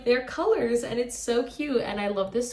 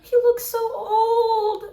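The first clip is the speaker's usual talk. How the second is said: whiny wail; 14 semitones higher, 8 dB louder, slower than usual. Real, not acted. Viewer wailing at the aged character, dismayed cry. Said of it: "repeated after the plain take".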